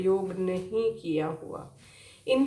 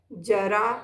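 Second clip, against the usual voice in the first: ordinary talk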